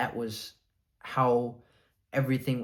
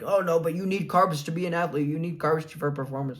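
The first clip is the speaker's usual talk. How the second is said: higher, louder mimicked protest; mock yelling objection; 6 semitones higher, 3 dB louder than usual. Critics protesting his no-carb diet, mock yelling. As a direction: imitate critics fretting in a raised, higher voice. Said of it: "yell at me and be like".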